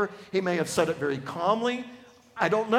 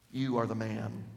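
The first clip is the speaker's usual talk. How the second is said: hushed accusation; 8 semitones lower, 6 dB quieter than usual. Acted. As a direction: quietly press YOU home. Nathan confronts David, first take.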